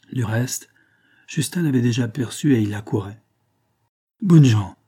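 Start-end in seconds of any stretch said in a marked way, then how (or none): 4.2-4.8 loud startled exclamation; surprised loud cry; exclaiming. A narrator's reading. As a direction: call out in surprise. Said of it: "s'exclama-t-il".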